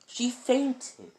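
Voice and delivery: high, loud squeaky protest; acted shrieking excuse; alarmed cry. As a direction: yell it in a squeal of panic. Naruto alarmed, tells Kakashi of fainting.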